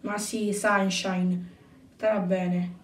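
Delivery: low, hushed, soothing tone; soft reassurance. Acted. Nick comforts a worried mother, low and soothing. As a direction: reassure very quietly, pitched well down.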